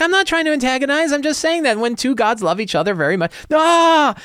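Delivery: very high, sing-song innocent voice ending in a yelp; innocent priest. Acted. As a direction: play the guileless believer who can't stop. Priest innocently repeats the heresy.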